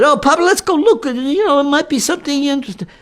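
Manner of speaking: high, pleading daughter's voice; insisting daughter. Retold or imitated. Daughter urging her father to look inside, high pleading. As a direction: coax your father into the shop. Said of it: "she insisted".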